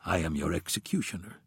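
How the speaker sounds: low, quiet, cold killer voice; calm menace, very quiet; cold assassin. A narrator's reading. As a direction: assassin speaks to his victim, quietly.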